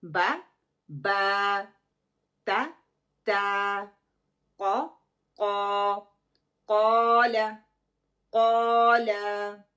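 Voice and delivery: बा ता फा कौला said loudly, each vowel held to two counts; loud slow letter drill, कौला with कौ and ला equal; counting demo. Shown as a demonstration. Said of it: "ये नहीं होगा कि कौ को मैं लंबा कर रही हूँ".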